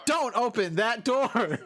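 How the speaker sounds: slow, dramatic movie-line echo; game character voice. Retold or imitated. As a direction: parrot the cutscene line, slowly. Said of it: "repeating, what, like, the characters say".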